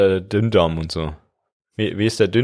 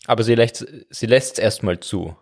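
usual speaking voice

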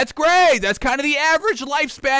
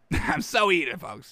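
laughing at himself; 6 semitones lower, 7 dB quieter, slower than usual. Real, not acted.

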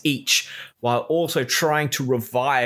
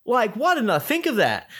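much higher, whiny mock voice; sneering high-pitched parody; mocking impression; 12 semitones higher than usual. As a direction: parody the know-it-all teammate. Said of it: "It's like".

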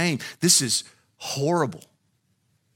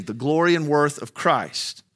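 ordinary delivery

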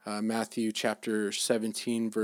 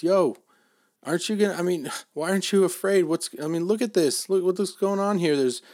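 higher-pitched, mock-panicked plea; retold alarmed disciple; 6 semitones higher, 4 dB louder than usual. Disciple nudging sleeping Jesus on the stormy boat, alarmed. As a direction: act out a worried disciple, pitched up. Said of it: "were terrified".

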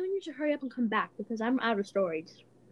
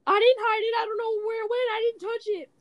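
high, whiny mock denial; high whining like a kid denying it; 11 semitones higher than usual. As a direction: play the friend as a pouty little kid. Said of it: "she was like".

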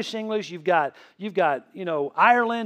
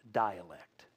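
dialect said low and very soft; hushed emphasis; 12 semitones lower, 10 dB quieter than usual. Real, not acted. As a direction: let the word dialect sink to a hush. Drops voice on key word.

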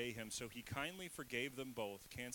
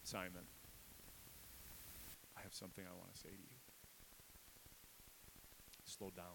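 lower, softer, hushed; God's gentle voice; 5 semitones lower, 12 dB quieter, faster than usual. Retold or imitated. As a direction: speak it down low and tender. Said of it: "He might as well have said".

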